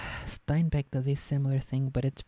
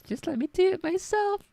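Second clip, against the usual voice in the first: exasperated; 5 dB louder than usual. Real, not acted. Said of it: "frustrated with typed accents".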